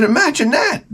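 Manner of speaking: high, dry mock amazement; fake-impressed "imagine that"; sarcastic. Sarcastic reply to the screaming.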